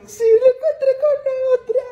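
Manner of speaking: hammed-up weepy lament; mock crying lady. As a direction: drag it out, sobbing like a jilted señora. Lady crying over her boyfriend.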